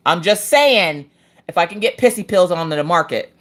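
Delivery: ordinary delivery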